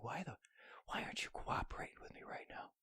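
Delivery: hushed unvoiced whisper to a stubborn part. Pleading in a whisper with a piece of hardware. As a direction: whisper the complaint right at it. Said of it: "I started whispering to it".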